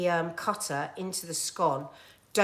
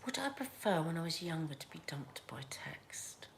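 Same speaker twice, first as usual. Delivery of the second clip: whispering; 2 semitones lower, 13 dB quieter than usual. Real, not acted. Musing aside.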